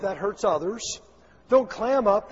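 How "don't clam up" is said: DON'T punched loud; warning not to clam up, preached; emphatic don't clam up. Shown as a demonstration. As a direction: hit don't hard, louder. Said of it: "preacher emphasis".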